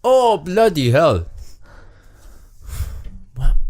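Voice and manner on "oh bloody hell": high, slow mock wail; drawn-out hammed-up accented dismay; stranded man's despair. Stranded man despairs, high comic wail. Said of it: "convey this message".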